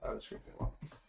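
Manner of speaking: whispering the meme line; whispered meme. Pretend. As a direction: breathe it as an aside.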